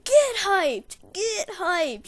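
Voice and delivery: loud, high, drawn-out yell; screaming hype cry. Retold or imitated. Player hyping the basketball team, screaming. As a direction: holler it high to fire up the team. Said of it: "started screaming as loud as I could".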